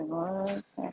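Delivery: soft whisper as the cat; curious pet in a hush. Pretend. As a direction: voice the cat's question in a whisper. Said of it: "He's like".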